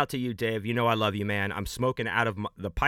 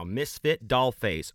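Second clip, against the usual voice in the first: normal talking voice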